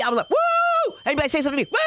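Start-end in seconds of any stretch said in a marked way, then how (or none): 0.3-1.0 high, loud kung fu yelp; a sharp Bruce Lee battle cry. Retold or imitated. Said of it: "my eyes looking like Bruce Lee".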